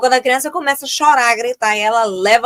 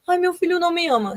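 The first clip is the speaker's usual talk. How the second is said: higher, plaintive mock parent; whiny parent; 7 semitones higher than usual. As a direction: whine like a hurt mother. Parent complaining to therapist. (mãe queixosa no consultório, voz aguda e lamentosa)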